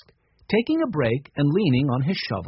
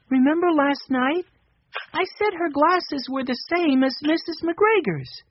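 put-on high, light little boy voice; a small boy musing aloud, pitched up; young Benny; 12 semitones higher than usual. A narrator's reading. Narrator voicing young Benny.

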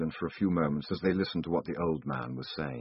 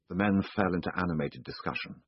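usual speaking voice

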